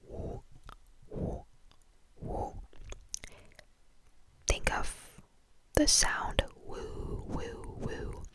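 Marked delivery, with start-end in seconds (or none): 0.1-2.5 "woo" as Mandarin wǔ, a rounded "oo", whispered; Chinese wu. Shown as a demonstration. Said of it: "which is". 5.7-8.2 "woo" as Mandarin wǔ, a rounded "oo", whispered; Chinese wu. Shown as a demonstration. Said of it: "a bit more round in the mouth".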